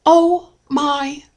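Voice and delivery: slow, high, drawn-out astonishment; floored gasp. Retold or imitated. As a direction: stretch the shock like the aunt did. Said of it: "very, very floored".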